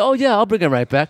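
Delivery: high, light caught-out voice; breezy promise. Retold or imitated. Friend caught with the book promises the manager, high and breezy. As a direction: toss off a casual promise. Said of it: "And he said".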